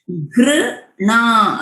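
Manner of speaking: गृणा slowly with गृ, not ग्र; correct गृ. Shown as a demonstration. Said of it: "ग्र और गृ में बहुत फर्क है". (गृणा धीरे, गृ पर ज़ोर)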